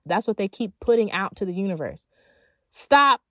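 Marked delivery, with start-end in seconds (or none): 2.7-3.2 one firm, loud, raised "stop"; emphatic command. Real, not acted. Abrupt command to break the habit.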